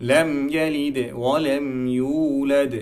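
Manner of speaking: slow recitation with qalqala on ইউলাদ; slow Quran recitation. Shown as a demonstration. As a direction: chant লাম ইয়ালিদ ওয়া লাম ইউলাদ slowly, bouncing the final দ. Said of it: "এখানে আমরা কলকলার উচ্চারণটা করব".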